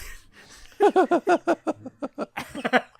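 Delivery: high, loud burst of laughing; loud laughter. Real, not acted. Teller cracking up at drunk couple story.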